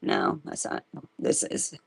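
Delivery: whispered to herself while searching; whispered aside. Real, not acted. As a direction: breathe it, barely there.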